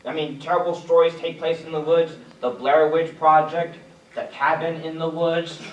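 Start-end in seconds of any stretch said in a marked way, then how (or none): none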